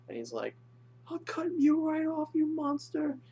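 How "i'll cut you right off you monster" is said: loud falsetto mock threat; hammed-up frantic outburst; mental breakdown. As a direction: imitate a hysterical, very high-pitched threat. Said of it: "He's having a mental breakdown".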